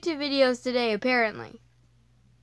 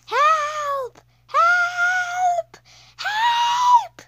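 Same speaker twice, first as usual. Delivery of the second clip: panicked cries rising higher and louder; terrified; 13 semitones higher, 4 dB louder, much slower than usual. Acted. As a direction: scream for help, climbing each time. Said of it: "I cannot make a scared voice".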